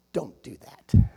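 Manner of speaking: whispered, low warning; hushed warning. Retold or imitated. Pastor whispering a warning to Brother Callahan, hushed. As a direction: breathe it as a private caution.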